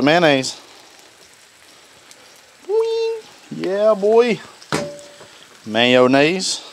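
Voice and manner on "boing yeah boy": silly high boing. Real, not acted. Playful sound effect.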